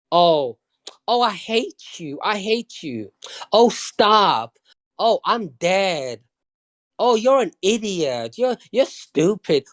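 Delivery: mock-insult them flirtatiously, stretching the words. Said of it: "That's how they flirt".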